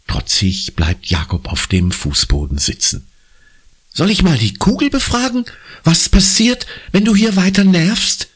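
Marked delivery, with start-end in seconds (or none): 3.9-8.3 cross. A narrator's reading. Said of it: "fragt Conny sauer".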